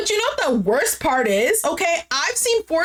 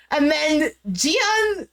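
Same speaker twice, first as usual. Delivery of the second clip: usual speaking voice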